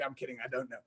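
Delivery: ordinary talk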